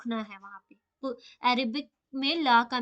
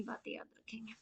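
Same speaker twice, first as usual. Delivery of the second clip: whisper; 9 dB quieter than usual. Real, not acted.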